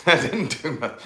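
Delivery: a laugh running through the words; laughing at the memory. Real, not acted. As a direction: laugh as you repeat it.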